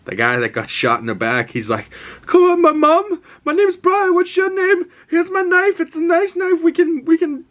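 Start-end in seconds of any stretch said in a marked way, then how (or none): 2.3-7.5 very high, loud imitation of frantic pleading; panicked begging. Dying young man begging his attackers to spare him, panicked begging. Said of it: "He's, like, freaking out, he's dying".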